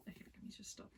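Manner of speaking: soft whispered aside. Real, not acted. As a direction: whisper it to yourself. Mutters to herself.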